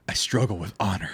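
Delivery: low, soft dramatic anime line; a hint of an anime hero; anime character voice. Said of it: "read it like an anime character".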